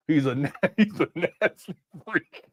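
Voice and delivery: words breaking up into laughter; laughing. Real, not acted. Host cracking up while calling the man a freak, laughing through the words.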